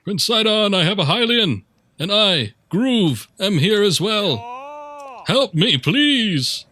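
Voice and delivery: high, loud distressed call; yelling for help. Acted. Stranded Zora calling to Prince Sidon for rescue, high yelling. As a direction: holler out to someone far away. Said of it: "Where even is he yelling?".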